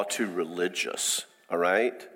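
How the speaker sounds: ordinary talk, nothing marked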